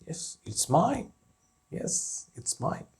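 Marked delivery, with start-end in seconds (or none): 1.8-2.9 whispered answer. Shown as a demonstration. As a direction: whisper the reply. Teacher repeats example answer twice.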